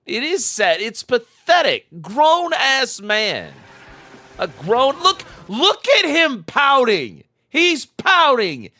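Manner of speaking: high, scornful running commentary; high-pitched scornful ridicule of a pouting grown man; mocking exasperation. Real, not acted. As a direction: ridicule him to the chat.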